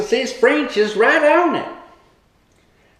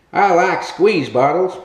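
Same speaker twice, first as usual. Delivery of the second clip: ordinary talk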